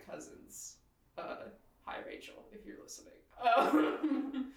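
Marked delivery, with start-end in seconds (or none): 1.8-3.1 softly unvoiced aside to a listener; hushed shout-out; quiet aside. Real, not acted. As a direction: drop to a near whisper for a shout-out. Aside to cousin.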